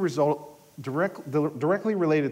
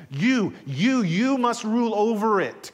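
"You, you, you" punched out higher and louder, one by one; emphatic stress on You; 6 semitones higher, 6 dB louder, slower than usual. Shown as a demonstration. Preacher showing how the Hebrew reads, emphatic stress on "You". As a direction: hit each "you" hard, then drive through "must rule over it". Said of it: "the Hebrew literally reads emphatically like".

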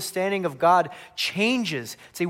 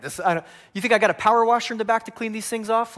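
fast, higher, louder comic protest; mock exasperation; 6 semitones higher, 5 dB louder, faster than usual. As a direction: fire back in hammed-up exasperation. Exasperated Jesus acted as joke.

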